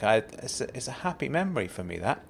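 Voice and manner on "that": ordinary talk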